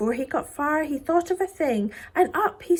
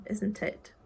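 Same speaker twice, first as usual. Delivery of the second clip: ordinary delivery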